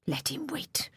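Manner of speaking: Daniel mimicking his father's cold dismissal; scornful whisper. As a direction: hiss it with disdain.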